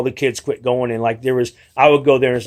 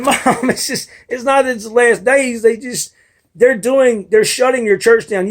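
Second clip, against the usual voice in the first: exasperated pleading; 7 semitones higher than usual. Retold or imitated. Arguing back to mother, raised.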